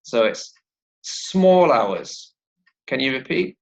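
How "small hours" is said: "small" stressed, its L running into "hours"; "small" held long, sliding straight into "hours"; stress on SMALL, linked. Shown as a demonstration. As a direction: join "small hours" as one unit, weight on "small". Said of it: "there's a stress on the word small and there's a liaison".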